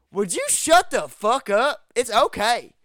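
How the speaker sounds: retold irritated shout; annoyed snap. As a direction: imitate a bloodied friend snapping at a nagging girl. Bloody Alan snaps at Jill.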